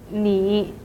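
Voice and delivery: 你 in a low dipping third tone. Shown as a demonstration. Teacher shows the word you alone, low third tone. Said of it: "pronounced as the third tone".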